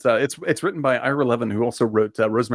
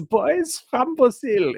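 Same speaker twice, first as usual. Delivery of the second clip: high, drawn-out mock Nazi accent; bad Nazi accent; 11 semitones higher, much slower than usual. Co-host showing off a bad film accent, hammed-up Nazi accent. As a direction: stretch it out in a hammed-up German accent. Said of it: "I'm the Meryl Streep of Nazi accents".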